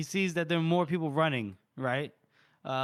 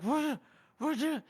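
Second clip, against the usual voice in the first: shocked; 11 semitones higher, slower than usual. Retold or imitated. Curly realizes he's stabbed.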